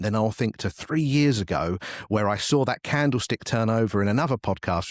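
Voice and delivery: ordinary talk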